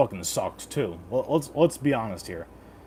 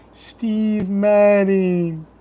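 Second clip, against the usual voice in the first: slow, high, loud ring announcer; 8 semitones higher, 7 dB louder, much slower than usual. Acted. Drawn-out announcer call.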